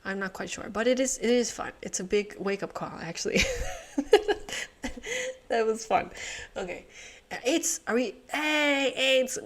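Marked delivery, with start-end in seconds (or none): 3.4-5.4 high laugh. Real, not acted. Laughs after fun.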